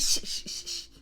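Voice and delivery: unvoiced shh; shushing the class. Teacher hushing her class, unvoiced shushing. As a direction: hush them with a breathy shush. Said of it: "She was like".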